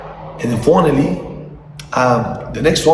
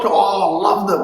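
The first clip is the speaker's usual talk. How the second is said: ordinary delivery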